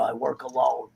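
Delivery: a mock gruff loner; lone wolf line. [name] voiced, loner line.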